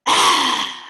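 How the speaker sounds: sudden loud breathy roar, tongue out; demo lion roar. Shown as a demonstration. Teacher demonstrates a lion roar to the kids. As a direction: roar wide-eyed to show the class. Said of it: "it goes like this".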